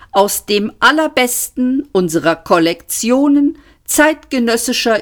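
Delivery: an ancient shop voice, courteous but menacing; polite menacing recording. A narrator's reading. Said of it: "drohende Unterton".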